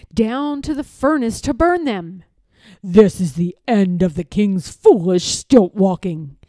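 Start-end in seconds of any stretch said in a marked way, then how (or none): 2.8-6.4 low, louder grumble of a scheming lord; grumbling villain. A narrator's reading. Lord Droon grumbling to himself about the stilts, grumbling. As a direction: voice the villain muttering his contempt. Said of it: "he mumbled to himself".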